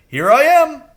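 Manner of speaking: very high, loud bird squawk of a greeting; squawky high crow hello. A narrator's reading. Crow Jeremy speaks.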